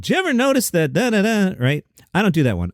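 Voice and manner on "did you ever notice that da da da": high, far-off observational Seinfeld voice; typical Seinfeld impression. Host mimicking the common Seinfeld impression, half-hearted. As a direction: mimic the classic "did you ever notice" bit. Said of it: "Most people do a Jerry Seinfeld along the lines of".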